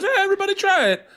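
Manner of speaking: very high, hammed-up cook's invitation; chef character voice. Comedian mimics the cook offering tastes, character voice. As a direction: offer it round in a high put-on voice. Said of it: "And he goes".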